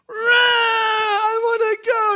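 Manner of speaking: long, loud high tantrum wail; wailing small child. Retold or imitated. Son too short for Everest, tantrum wail. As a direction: howl like a kid turned away from a ride. Boy refused ride, wailing.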